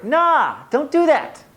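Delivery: high, loud, drawn-up "nah"; emphatic rejection. Acted. Host waving off dress-for-work advice, emphatic rejection.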